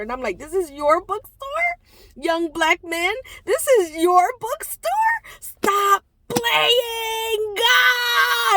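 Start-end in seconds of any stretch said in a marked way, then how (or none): 0.4-7.5 high, loud, squealing astonishment; thrilled disbelief. Retold or imitated. Telling the young owner she can't believe it's his, excited squeal. 7.5-8.6 excited exclamation. Real, not acted. Excited outburst.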